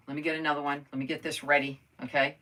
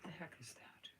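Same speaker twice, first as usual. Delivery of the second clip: whisper; 25 dB quieter than usual. Real, not acted. Puzzled, mutters to herself.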